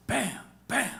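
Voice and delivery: two high, sudden vocal gunshots; imitated gunshots. Joke teller acting out the maid shooting both, imitated bangs. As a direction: punch out each shot high.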